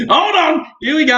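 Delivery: high-pitched, laughing excitement; excited high call. Real, not acted. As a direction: squeak it out, still laughing. Bursts out mid-laugh.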